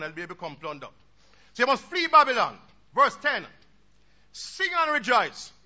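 loud, ringing pulpit voice; full-force sermon delivery; fiery preaching. Acted. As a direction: preach it out to the congregation. Preaches scripture loudly.

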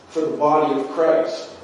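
normal talking voice

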